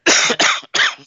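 sharp loud cough; real cough; coughing fit. Real, not acted. Sick cough.

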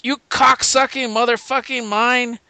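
high-pitched, hammed-up cursing tirade; mock-furious general; cussing general. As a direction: hurl the insult like a vulgar commander. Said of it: "he cusses like nobody's business".

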